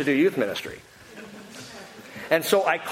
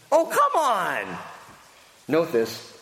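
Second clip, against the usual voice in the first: ordinary talk, nothing marked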